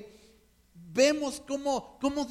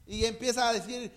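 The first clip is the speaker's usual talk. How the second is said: ordinary talk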